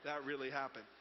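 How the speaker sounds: low mock embarrassment; imitated dawning regret. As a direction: realize it ruefully, lightly acted. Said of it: "looking at that the next day going".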